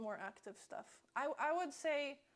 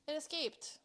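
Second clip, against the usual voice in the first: ordinary delivery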